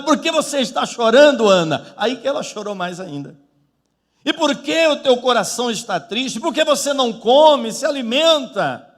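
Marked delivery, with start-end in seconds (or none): 0.0-1.9 loud, raised-pitch questioning; acted-out husband's impatient question; exasperated husband. Husband questioning crying wife. 4.2-8.9 raised pitch, pressing questions; acted-out husband's loud reproach; exasperated husband. Husband pressing his grieving wife.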